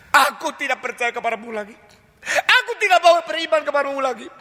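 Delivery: high, petulant renouncing of faith; a bitter believer giving up on God; defiant refusal. Acted. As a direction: sulk at God, turning away.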